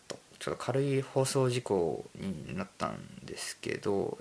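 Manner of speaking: ordinary talk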